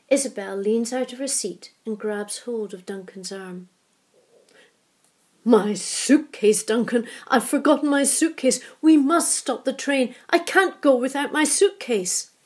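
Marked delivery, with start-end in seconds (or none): 5.5-12.3 loud, high, frantic cry; wife panicking over her forgotten suitcase; panicked old lady. A narrator's reading. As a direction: wail it in sudden panic.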